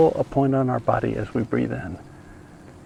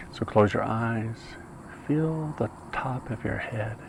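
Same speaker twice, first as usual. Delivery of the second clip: guided meditation; 9 dB quieter than usual. Real, not acted. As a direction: guide them gently from breath to breath, unhurried.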